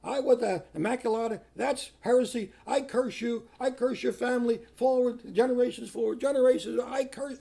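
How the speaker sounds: high, loud mock screaming curse; hammed-up furious rant, pitched high and loud; retold hysterical curse. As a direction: imitate a woman shrieking curses at the priest. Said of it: "she starts screaming in hysteria at me".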